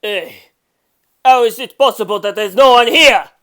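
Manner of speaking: loud angry yell for the staff; yelling for someone to come. Acted. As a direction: shout it out to the empty room. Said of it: "he yelled at last".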